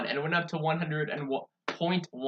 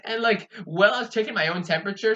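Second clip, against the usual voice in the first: ordinary delivery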